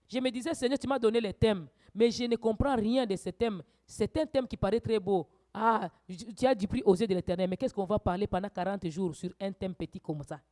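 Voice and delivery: ordinary delivery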